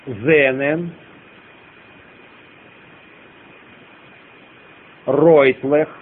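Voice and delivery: one word at a time, long silences; slow dictation. Shown as a demonstration. Teacher dictating the next line to students, slow dictation. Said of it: "я медленно продиктую".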